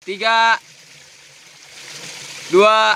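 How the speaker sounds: shouted countdown. Real, not acted. Group countdown.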